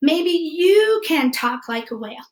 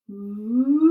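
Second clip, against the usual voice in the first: whale sound; 5 semitones lower than usual. Shown as a demonstration. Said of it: "Why don't you try that again?".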